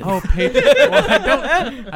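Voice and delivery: laughing. Real, not acted. Flustered when asked for patron names.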